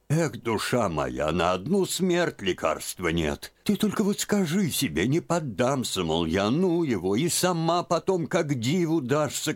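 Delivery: put-on high old woman's voice, coaxing; coaxing old aunt. A narrator's reading. Aunt coaxing Liza to bear it, high. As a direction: talk her out of her grief.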